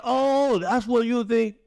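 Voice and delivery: high, loud indignant scoff; mock offended critics. Mocking imagined critics who call him out, with a high indignant scoff. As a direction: play an outraged critic catching him out. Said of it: "they're gonna be like".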